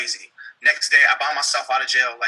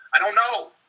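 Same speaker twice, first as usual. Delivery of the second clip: "I don't know" pushed up and louder; getting loud; 6 semitones higher than usual. Retold or imitated. To the cop in interrogation, frustrated and raised. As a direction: snap it back in frustration. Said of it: "I kinda got loud with him".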